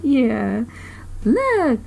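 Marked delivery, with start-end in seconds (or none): 1.2-1.8 higher mild shout from below; understated shout. A narrator's reading. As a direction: point it out to the crowd. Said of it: "someone shouted from below".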